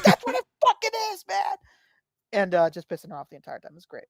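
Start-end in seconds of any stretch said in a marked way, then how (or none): 0.0-1.6 high, strained comeback with a laugh; imitated whiny defiance, half laughing; mock exasperated insistence. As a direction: protest it in a hammed-up high voice. Acting out his insistent comeback.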